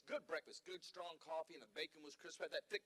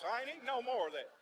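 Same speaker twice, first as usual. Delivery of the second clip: higher and more emphatic repeat; firmer refusal; 5 semitones higher, 8 dB louder than usual. Shown as a demonstration. Comic refusing the grits, emphatic fed-up repeat. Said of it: "repeats it".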